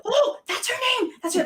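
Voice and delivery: high, excited gasp of recognition; excited realization. Retold or imitated. Fan spotting her friend's album in line, excited.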